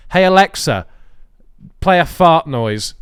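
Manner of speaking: calling out loud to the speaker; loud command to Alexa. Retold or imitated. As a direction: bark the command across the room. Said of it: "If it's playing out people's T Vs".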